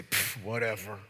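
a lazy, dismissive "Pff, whatever"; mock shrug of a reply. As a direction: brush it off like you don't care. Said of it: "like Martin would".

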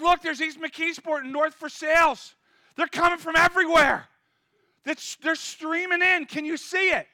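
loud, high and amazed; excited shout. Acted. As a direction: point and call it out. Disciple pointing at crowds.

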